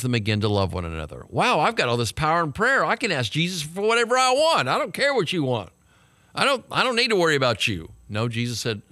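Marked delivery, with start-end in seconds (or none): 1.3-7.9 higher, mock-greedy disciple voice; selfish disciple. Mocking the disciples gone power hungry, higher and smug. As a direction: play a power-hungry, self-absorbed follower. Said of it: "lest the disciples become selfish and power hungry".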